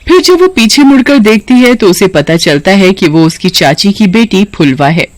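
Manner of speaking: plain reading voice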